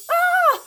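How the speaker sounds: high loud short shriek; startled cry of fright; hammed-up scream; mock scream. Caught in spider web.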